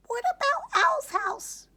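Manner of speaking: very high, squeaky mouse voice; tiny field mouse. A narrator's reading.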